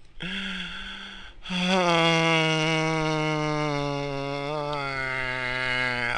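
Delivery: long weary sigh; exhausted sigh at one in the morning. Real, not acted. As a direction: let out a tired groan of a sigh. Exhausted at 1am.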